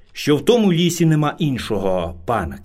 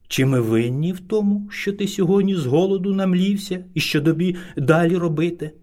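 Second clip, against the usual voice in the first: plain reading voice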